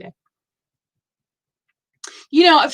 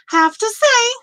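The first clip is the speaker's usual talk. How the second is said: playful echo; 11 semitones higher, 5 dB louder than usual. Real, not acted. Repeats phrase for emphasis.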